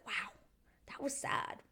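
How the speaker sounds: hushed whisper. Retold or imitated. Reacting to the sad breakup, whispered. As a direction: breathe it out as a whisper. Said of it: "and I was like".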